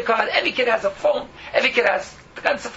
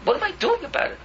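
ordinary talk, nothing marked

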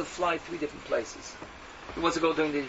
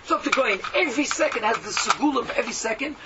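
rising exhortation; 7 semitones higher than usual. Real, not acted.

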